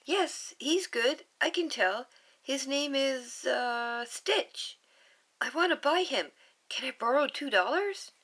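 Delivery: put-on high, pleading child voice; little girl voice. Little Lilu pleading with her sister, high child voice. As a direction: beg sweetly like a little girl.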